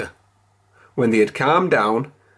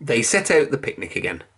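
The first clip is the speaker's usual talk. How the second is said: usual speaking voice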